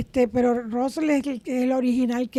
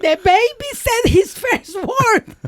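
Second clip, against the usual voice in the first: high, slow, thrilled parent voice; delighted mom hearing a first word; excited parent; 10 semitones higher, slower than usual. Retold or imitated. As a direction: gush it like a proud parent. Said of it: "and you are like".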